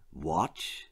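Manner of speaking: low, hushed "Watch"; quiet command. A narrator's reading. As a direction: say it softly and low. Old man commands quietly.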